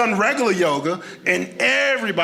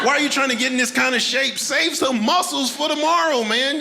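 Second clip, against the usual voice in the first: mock yell; 5 semitones higher than usual. Yelling at CrossFit lifters.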